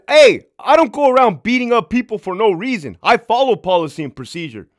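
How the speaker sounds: loud, high defensive protest; indignant. Retold or imitated. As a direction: defend yourself hotly. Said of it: "I said".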